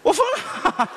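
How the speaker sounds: shocked laugh. Acted. Shocked reaction to donating money.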